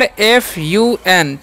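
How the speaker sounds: letters read out slowly one by one; spelling out letters. Shown as a demonstration. Teacher spelling the word to students, slowly. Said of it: "क्या लिखा हुआ है यहाँ पर".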